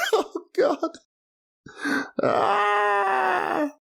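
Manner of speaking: high cringing cry at his own words; real squeal of embarrassment; a sudden high cringe; cringing groan. Real, not acted. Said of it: "I hate the sound of that".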